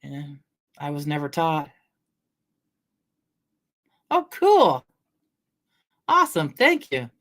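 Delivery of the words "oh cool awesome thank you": high, bright burst of thanks to chat; light up at the donation; excited thanks. Real, not acted. Streamer thanking a viewer for a gift, excited.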